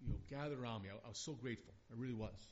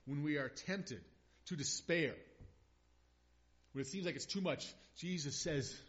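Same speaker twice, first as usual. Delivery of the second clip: rising preacher's fervour, louder; preaching; 4 semitones higher, 8 dB louder than usual. Acted. Preacher urging a grieving congregation, fervent and loud. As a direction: build it up from the pulpit.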